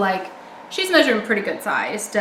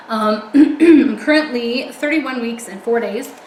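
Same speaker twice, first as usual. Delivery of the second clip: ordinary talk, nothing marked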